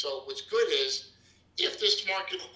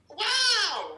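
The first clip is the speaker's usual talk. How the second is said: high, loud drawn-out wow; mock amazement; 7 dB louder than usual. Real, not acted. Trader hyping a Kiyosaki quote, loud mock wow. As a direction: gasp it like a revelation.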